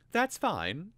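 high, eager but held back; restrained eagerness. A narrator's reading. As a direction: hide how keen you are. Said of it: "trying not to sound too eager".

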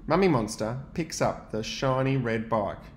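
usual speaking voice

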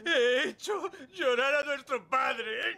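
high, slow, sobbing confession. Acted. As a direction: blubber it out in full tears. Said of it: "Pues yo sí".

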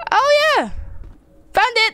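excited victorious yell; triumphant. Real, not acted. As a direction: crow over the lucky find. Celebrating finding the safe.

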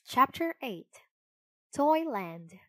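ordinary delivery

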